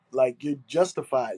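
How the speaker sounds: normal talking voice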